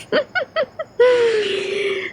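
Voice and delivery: high, soft giggle; small high-pitched laugh. Real, not acted. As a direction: giggle lightly after bleating like a sheep. Giggle after sheep bleats.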